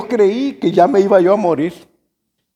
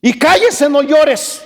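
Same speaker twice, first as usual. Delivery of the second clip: stern uncle; 5 semitones higher than usual. Retold or imitated. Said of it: "Y me dice".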